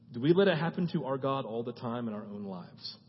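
normal talking voice

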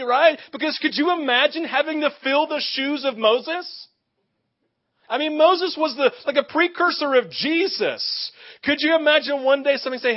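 usual speaking voice

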